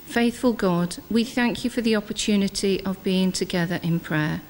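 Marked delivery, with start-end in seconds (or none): none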